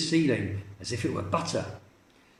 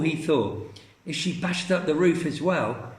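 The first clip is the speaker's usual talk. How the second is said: ordinary delivery, nothing marked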